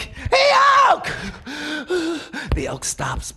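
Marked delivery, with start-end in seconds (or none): 0.0-1.1 high, loud yell; shout. Acted. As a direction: yell it with all your courage. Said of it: "he yelled at that elk".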